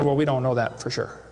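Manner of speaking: low, lightly voiced professor; mock professor. Professor admitting to a questioner that he doesn't know, mock low voice. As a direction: imitate a professor's answer, pitched right down. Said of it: "I asked this professor".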